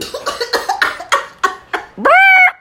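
a scoffing cough at his nonsense; mock cough. Real, not acted. Scoffing at homeboy's words with a fake cough.

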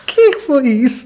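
asking through laughter; laughing. Real, not acted. Laughing reaction. (a rir-se)

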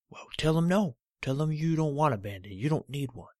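a cranky toe refusing the Band-Aid; stubborn talking toe. Acted. Cut toe protesting to Olivia, loud and grumpy.

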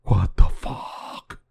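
slow, breathless whisper of shock; whispered disbelief. Real, not acted. Vlogger rereading the UFO headline in a stunned, slow whisper. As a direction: let it fall to a stunned whisper.

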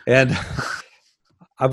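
chuckling through the words; laughing. Real, not acted. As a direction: laugh it off sheepishly. Laughs at own tangent.

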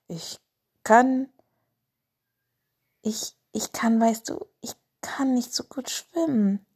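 halting, whispered stammer over kann; nervous boy stumbling over his words; stuttering. A narrator's reading. Lars admits he can't swim, stammering. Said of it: "stotterte Lars".

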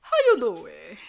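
a low, light Wendy Williams greeting; answering Ashley with a light Wendy Williams voice; Wendy Williams impression. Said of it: "You sound like her a little bit".

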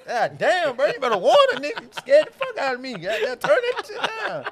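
loud high shocked shouting; startled yelling. Real, not acted. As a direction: yell in fright, half laughing. Startled by a scream.

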